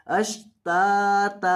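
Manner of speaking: अश्ताता with both ता stretched slowly; long vowels. Shown as a demonstration. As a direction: draw out each ता of अश्ताता. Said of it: "दोनों को लंबा करना है". (अश्ताता, दोनों ता लंबी)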